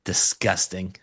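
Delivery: hammed-up disgust; mock disgust. Shown as a demonstration. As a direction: show how to groan at a dad joke. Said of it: "You're supposed to go".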